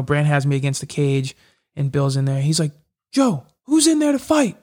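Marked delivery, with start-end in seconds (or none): 3.1-4.6 high, pushing question; coach's challenge. Retold or imitated. Coach firing up his fighter between rounds, high challenge. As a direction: fire up your fighter.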